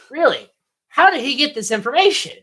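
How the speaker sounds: high-pitched disbelief; incredulous. Real, not acted. Podcaster questioning Billy's knowledge, high incredulous voice. Real incredulity at plot hole.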